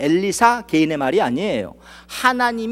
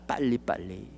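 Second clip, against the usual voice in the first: much softer, quick under-the-breath 빨리빨리; mock hurried murmur; 10 semitones lower, 14 dB quieter than usual. Shown as a demonstration. Preacher mimicking people's rushing, hushed. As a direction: toss off 빨리빨리 as a hushed hurry. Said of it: "그냥 빨리빨리".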